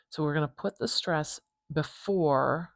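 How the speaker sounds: ordinary talk, nothing marked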